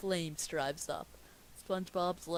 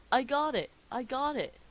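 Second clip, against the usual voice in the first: high, loud excited cry; pirate calling out; 6 semitones higher, 6 dB louder than usual. Acted. Pirate in the dinghy calling to the ship, shouting. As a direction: yell it up to the ship.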